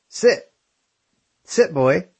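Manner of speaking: a high, firm call of "Sit!" at the dog; dog command. Acted.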